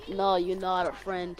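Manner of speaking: low, slow, quiet mock rejection; understated, low-pitched dismissal; retold rejection. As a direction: imitate turning someone away, low and slow. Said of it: "'cause we're like".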